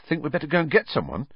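jittery schoolboy hurrying the words; nervous. Acted. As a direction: blurt it out, wanting to leave. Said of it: "said Ron nervously".

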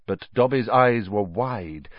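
ordinary delivery, nothing marked

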